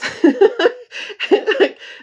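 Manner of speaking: high burst of laughter; loud laugh. Real, not acted. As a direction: let a big laugh break out. Big laugh.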